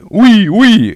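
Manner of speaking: very high, loud "ouille" squeals; yelping in pain. A narrator's reading. Boy holding his nose after the door hit him, yelping. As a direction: squeal twice as if your nose is hurt. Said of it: "il faisait".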